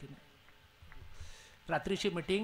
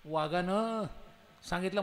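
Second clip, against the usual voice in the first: ordinary delivery, nothing marked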